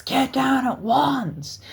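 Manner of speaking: a high, slow shouted command; shouted order. Retold or imitated. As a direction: bark the order at the fleeing kids. Guard still yelling at them.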